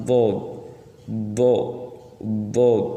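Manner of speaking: bod, bod, bod said with a heavy, drawn-out d; over enunciating. Shown as a demonstration. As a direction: stretch each bod so the final d is clearly heard. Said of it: "Jadi beda sama shod".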